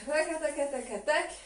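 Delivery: rapid "rakataka" percussion with the mouth; rhythmic vocal rattle. Shown as a demonstration. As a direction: sound out the hip trembling beat. Teacher voices the trembling rhythm.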